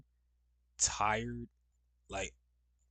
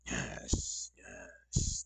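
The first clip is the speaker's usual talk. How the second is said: usual speaking voice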